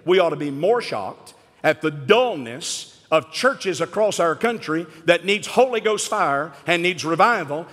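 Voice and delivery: ordinary talk, nothing marked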